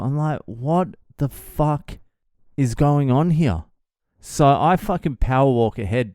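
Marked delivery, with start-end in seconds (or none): 0.5-3.6 slow, higher "what the fuck"; retold bafflement, pitched up; bewildered disbelief. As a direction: act out baffled disbelief, stretching the words. Bewildered reaction to being cheered.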